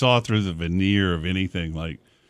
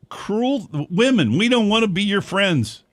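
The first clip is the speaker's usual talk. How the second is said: mock cruel women; 9 semitones higher than usual.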